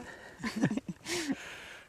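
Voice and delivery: short high-pitched giggle; amused laugh. Real, not acted. Host jokes about being intimidated by a viewer's behavior, amused giggle. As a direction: giggle after your own joke.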